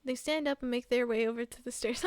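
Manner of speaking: ordinary talk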